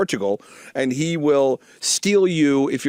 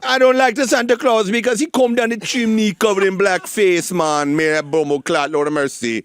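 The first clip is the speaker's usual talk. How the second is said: louder, higher hammed-up Jamaican accent; mock Jamaican accent; 4 semitones higher, 3 dB louder than usual. Answering the cohost as a Jamaican postal worker, hammed-up Jamaican accent. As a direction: play a Jamaican postal clerk griping about Santa. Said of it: "How about if it's a Jamaican postal worker?".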